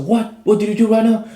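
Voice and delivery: a high, loud, startled what?; shocked outrage. Retold or imitated. Officer, pushed away, shouts in shocked disbelief at him. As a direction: fire it back in disbelief, pitch up. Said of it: "he's shocked".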